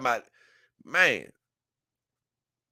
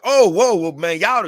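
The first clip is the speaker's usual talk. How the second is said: a mock startled shout, higher and louder; retold panicked cry; 7 semitones higher, 9 dB louder than usual. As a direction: yelp it like the spray just hit. Man panicking after pepper spray.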